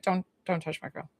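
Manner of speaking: mock-gruff dad, dropped low and soft; a protective dad mutters a quiet warning; low possessive warning. Dad guarding his grill at the barbecue, quiet and low. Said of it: "and he's like".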